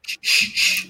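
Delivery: unvoiced hushing; shushing. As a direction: hiss them quiet. Sneaking into arcade, shushing.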